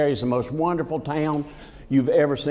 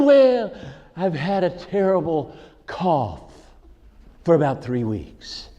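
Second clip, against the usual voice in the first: funny-talking barber; slower than usual. Retold or imitated. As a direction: imitate the ailing barber's drawn-out talk. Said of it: "But he talked funny".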